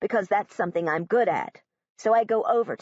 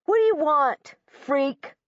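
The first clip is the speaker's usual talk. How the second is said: sneering bully; 7 semitones higher, 4 dB louder than usual. Acted. Bully Josh sneers at girl.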